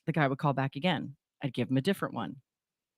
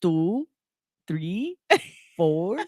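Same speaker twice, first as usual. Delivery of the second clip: spaced-out counting in a put-on Indian accent; 3 semitones higher, much slower than usual. Mother imitating her son reciting a fake number, Indian accent. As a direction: count one by one, lilting upward, Indian accent. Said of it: "in an Indian accent that".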